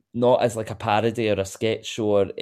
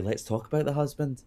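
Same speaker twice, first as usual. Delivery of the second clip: quoting a Housewife; 6 semitones higher than usual. Retold or imitated. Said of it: "I'll just have to say".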